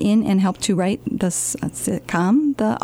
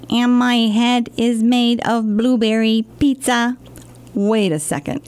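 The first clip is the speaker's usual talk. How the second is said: ordinary delivery, nothing marked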